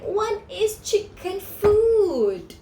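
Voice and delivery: high, slow mock shout; a hammed-up despairing cry to nobody. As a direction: call out to no one, drawn out. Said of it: "shouting to no one in particular".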